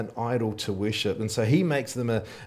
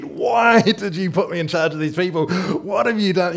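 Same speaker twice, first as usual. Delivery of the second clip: higher, louder, exasperated complaint; exasperated Moses; 6 semitones higher, 5 dB louder than usual. Retold or imitated. Preacher imitating a fed-up Moses. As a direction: throw up your hands at God.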